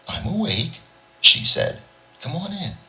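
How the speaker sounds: high, light woman's voice; girlfriend voice. A narrator's reading. Girlfriend Lil greets George at her door, high and light. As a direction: lift the voice for Lil.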